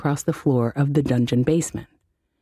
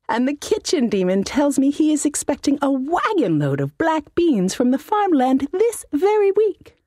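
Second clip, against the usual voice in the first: higher, smug threatening voice; gloating wizard; 12 semitones higher than usual. Acted. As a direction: savor the threat.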